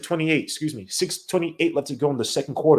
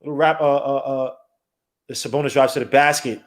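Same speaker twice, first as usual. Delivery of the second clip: ordinary talk, nothing marked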